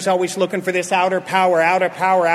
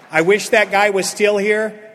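ordinary talk, nothing marked

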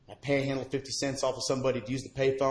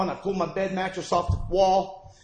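ordinary talk

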